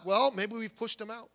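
normal talking voice

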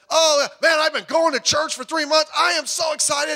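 high, loud, overjoyed shout; shouting with excitement; gushing excitement. Retold or imitated. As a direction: burst out like a thrilled new convert.

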